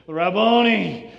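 loud, drawn-out joyful call; affectionate cry. Acted. Mary recognizing her risen teacher, loud affectionate cry. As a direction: cry it out slowly at full voice. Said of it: "an affectionate way of saying rabbi".

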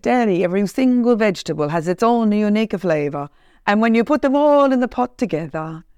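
imitated Italian restaurant owner, savouring it; Italian accent. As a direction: tell it like an Italian cook, in accent. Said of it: "and I do accents".

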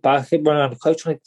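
ordinary talk

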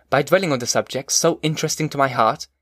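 ordinary delivery

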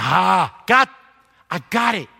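loud burst of realization; sudden realization. Acted. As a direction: hit the moment he finally gets it.

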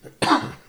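sharp coughing; coughing hard; coughing fit. Real, not acted. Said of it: "I'm still coughing".

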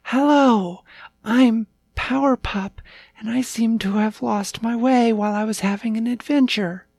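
put-on high-pitched young pup voice; eager little puppy. A narrator's reading. Lost puppy meeting the Cat King, high and eager. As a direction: introduce yourself brightly in a high puppy voice.

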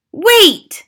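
sudden high, loud shriek. A narrator's reading. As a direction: shriek to halt everyone. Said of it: "shrieked one of the witches".

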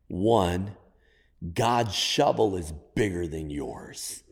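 ordinary talk

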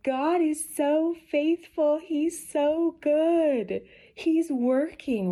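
high, drawn-out delighted gushing; high-pitched grateful awe; joyful praise. Retold or imitated. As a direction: marvel at it, stretching the words high. Recalls her joyful praise of God.

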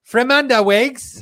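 slow, high mock Australian "Fremanda wigs"; Australian accent. Viewer asks for the slogan in a mock Australian accent. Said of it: "an Australian accent".

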